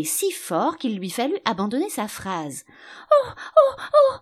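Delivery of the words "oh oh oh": loud, very high cries of pain; howling. A narrator's reading. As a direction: wail "oh" like a steam whistle. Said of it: "hurlait la reine".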